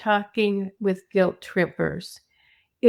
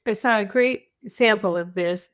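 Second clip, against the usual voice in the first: normal talking voice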